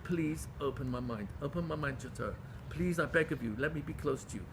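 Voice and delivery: hushed, humble plea to God; soft begging prayer. Shown as a demonstration. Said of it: "so that you can beg him".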